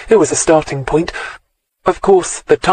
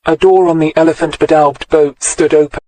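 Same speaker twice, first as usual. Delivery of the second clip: usual speaking voice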